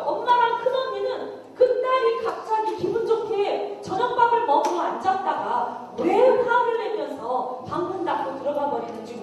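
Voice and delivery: ordinary talk, nothing marked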